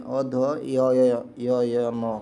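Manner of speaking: अध य य य य न spelled out slowly, each य separate; syllable by syllable. Shown as a demonstration. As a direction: break अध्ययन into its letters, very slowly. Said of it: "तो ये वाला हो जाएगा अध्ययन में".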